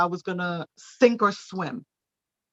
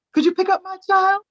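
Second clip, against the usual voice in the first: parent asking; 5 dB louder than usual. Retold or imitated. Parent's request voiced in story.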